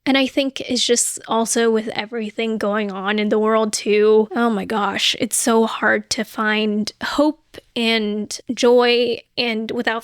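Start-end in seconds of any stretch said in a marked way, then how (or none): none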